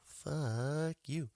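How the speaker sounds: mock dismissal. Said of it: "They said".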